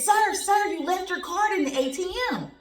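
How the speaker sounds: loud, high call to a stranger; loud high call, sir sir. Retold or imitated. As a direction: call after him across the lot. Calling out to the man.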